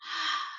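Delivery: soft voiceless puff of breath; isolated h sound. Shown as a demonstration. Teacher showing what H says by itself, breathy h. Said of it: "H by itself says".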